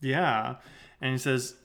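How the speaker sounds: usual speaking voice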